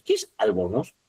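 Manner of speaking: ordinary talk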